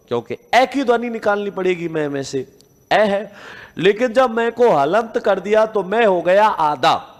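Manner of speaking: ordinary talk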